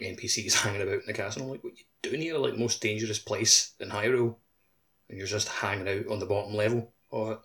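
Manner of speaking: ordinary delivery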